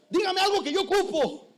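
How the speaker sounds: high mock whine; demanding lady. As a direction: plead for something useful. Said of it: "me dijo".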